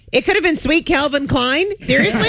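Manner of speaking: high, disbelieving pitch rising on seriously; incredulous. Retold or imitated. Incredulous retort to [name].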